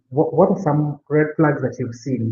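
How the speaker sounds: ordinary talk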